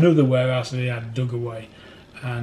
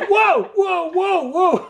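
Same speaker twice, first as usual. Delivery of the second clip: loud, very high comic howls; exaggerated high-pitched yelps; mock pain yelps; 7 dB louder, much slower than usual. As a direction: act out helpless yelping in pain.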